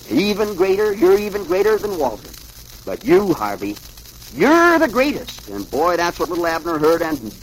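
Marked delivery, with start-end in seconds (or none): 0.1-5.5 high admiration building to a loud peak; escalating gushing praise. A narrator's reading. Overheard voice praises Andy then Harvey, high and gushing. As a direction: climb higher with each compliment.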